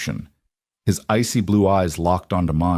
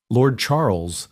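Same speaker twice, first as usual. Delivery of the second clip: plain reading voice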